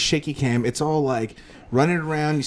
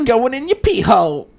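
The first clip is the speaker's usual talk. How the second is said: high silly joking voice; 8 semitones higher, 5 dB louder than usual. Pretend. Joking about the prize.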